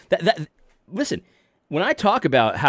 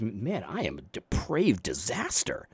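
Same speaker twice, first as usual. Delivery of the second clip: low, soft, slow admission of being depraved; hushed confession; 6 semitones lower, 12 dB quieter, slower than usual. Retold or imitated. To himself about his sin, softly. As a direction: let it sink in quietly.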